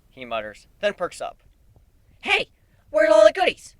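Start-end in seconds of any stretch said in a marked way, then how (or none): 2.2-3.8 much higher, louder, eager question; perky eager. Acted. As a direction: perk right up. Nightmare perks up.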